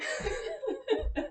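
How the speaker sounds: bright high-pitched laugh. Real, not acted. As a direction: burst out laughing softly. Laughs at own dad joke.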